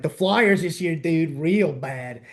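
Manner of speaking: Philly-accented example, slowing at the end; put-on Philly sports grumble. Shown as a demonstration. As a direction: drag out "real bad" in a Philadelphia accent. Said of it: "pronouncing their A's".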